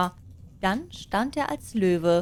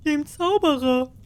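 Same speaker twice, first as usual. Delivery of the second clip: loud, higher voice of field workers; peasants answering; 7 semitones higher, 5 dB louder than usual. Acted. Farmhands shout back to the cat, loud and high. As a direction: call back as the mowers in the meadow.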